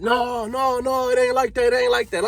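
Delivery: imitating a nervous guy backing off; pleading. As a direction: beg him to calm down. Man pleading after the shot.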